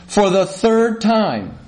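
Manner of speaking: slow, mock-irritated retort; incendiary. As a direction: stretch it out like a fed-up husband. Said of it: "here's where the incendiary thing can start".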